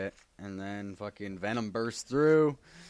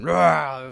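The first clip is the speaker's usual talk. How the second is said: monster growl; 6 semitones higher, 10 dB louder than usual. Retold or imitated. Venom mask comes back on.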